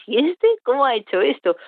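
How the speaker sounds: high-pitched, incredulous question; astonished reaction. Retold or imitated. Keeper amazed by what a chimpanzee did, astonished. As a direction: voice your amazement, pitch jumping up.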